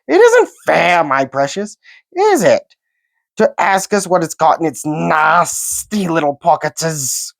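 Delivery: high, whining creature complaint; whiny Gollum voice. A narrator's reading. Gollum whining to his precious about Bilbo's pockets. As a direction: sulk and whine, pitch leaping.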